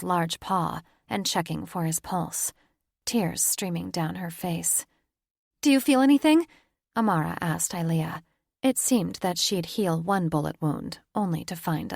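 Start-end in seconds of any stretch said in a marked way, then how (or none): 5.6-6.5 frantic. A narrator's reading. Frantic healing of dying father.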